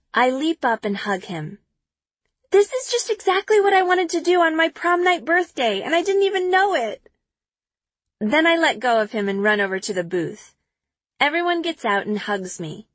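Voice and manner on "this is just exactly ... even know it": excited. A narrator's reading. Joyful narration after hug.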